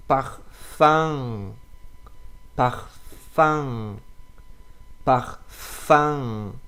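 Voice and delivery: Parfum with nasal final vowel, no n sound; French nasal ang. Shown as a demonstration. Teacher modeling Parfum for learners, nasal vowel. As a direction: say Parfum through the nose. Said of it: "This is ang".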